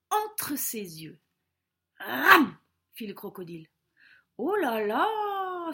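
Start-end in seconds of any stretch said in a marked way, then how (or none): 2.0-2.6 sudden loud chomping roar; crocodile snap. Acted. Crocodile lunges at the bird on its head, loud snap. 4.4-5.7 high, long drawn-out "oh là là"; startled exclamation. Acted. César dodges the crocodile's jaws, high and startled.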